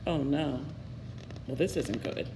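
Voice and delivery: low, soft worried aside to the listener; quiet aside. Real, not acted. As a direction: drop the voice as if sharing a worry. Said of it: "narrator aside to listener".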